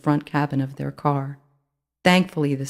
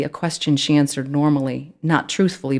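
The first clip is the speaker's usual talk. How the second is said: usual speaking voice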